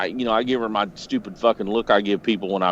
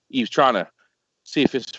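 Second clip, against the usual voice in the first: usual speaking voice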